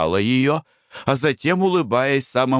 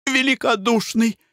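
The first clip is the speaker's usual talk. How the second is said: high, rapturous cry; rapturous exclamation; 12 semitones higher than usual. A narrator's reading. Blanche exclaims rapturously to generous Harry, high. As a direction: exclaim in delight. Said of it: "восторженно воскликнула Бланш".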